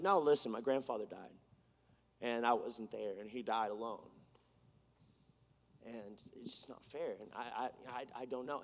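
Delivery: low, very soft grief; halting, subdued recreation of breaking down; retold bawling. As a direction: let it sink to a pained near-murmur. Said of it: "I just start bawling".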